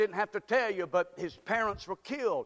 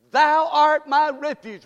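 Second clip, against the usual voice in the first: slow, loud lead line for the congregation; preacher leading the church in unison; call and response lead; 7 semitones higher, 11 dB louder, slower than usual. Shown as a demonstration. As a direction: lead them in saying it. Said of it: "Would you say that with me?".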